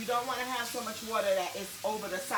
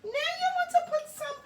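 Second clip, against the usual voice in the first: very high, slow, hammed-up cooking-show voice; Julia impression; 5 dB louder, slower than usual. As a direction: put on a drawn-out, sky-high TV cook voice. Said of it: "But you gotta do the voice".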